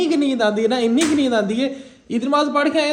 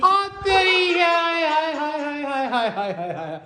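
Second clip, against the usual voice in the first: mock choking; 5 semitones higher, slower than usual. Smoke went up his nose.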